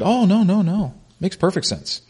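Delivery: high, soft, airy brush-off; mock dismissive. Baal worshipers answering the prophets, blithely dismissive. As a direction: imitate someone waving away an objection.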